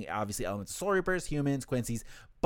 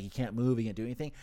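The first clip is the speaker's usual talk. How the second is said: ordinary talk, nothing marked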